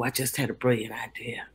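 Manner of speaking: sudden whisper of an idea; whispered eureka; excited whisper. Real, not acted. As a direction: whisper it like a secret.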